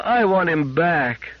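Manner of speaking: put-on high, slow, aching child voice; slow pleading child; drawn-out child plea. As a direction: stretch it out as a plea to mama. Child begging mother.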